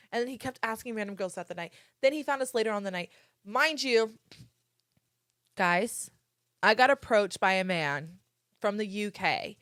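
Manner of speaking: ordinary talk, nothing marked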